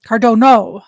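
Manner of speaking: normal talking voice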